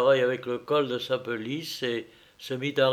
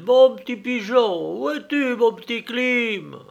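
calling out; 10 semitones higher, 6 dB louder than usual. Acted. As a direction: call him back sweetly into the dark. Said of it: "cria-t-il".

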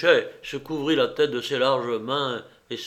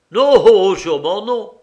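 booming captain's protest; emphatic refusal; 8 semitones higher, 12 dB louder than usual. A narrator's reading. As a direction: burst out in scornful denial.

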